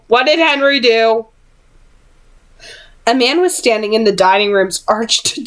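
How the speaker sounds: ordinary delivery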